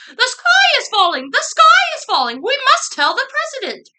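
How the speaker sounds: high, loud, hurried squawk; panicked hen clucking. A narrator's reading. Chicken Licken after something fell on her head, frantic clucking. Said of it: "running around in circles clucking".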